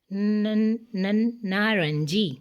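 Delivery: slow ن ن, then stretched نارنجی; sounding out again. Shown as a demonstration. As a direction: isolate the ن then say نارنجی slowly. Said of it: "دوبارہ سنیں". (ن ن کہہ کر نارنجی آہستہ)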